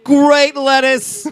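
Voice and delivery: very slow, high, booming announcer; stadium announcer. Pretend. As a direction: boom it like a PA announcer. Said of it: "they'll be like".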